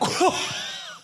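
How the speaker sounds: sudden high laugh of disbelief; burst of laughter. Real, not acted. Pastor laughing at his friend skipping the prequel, high burst of laughter.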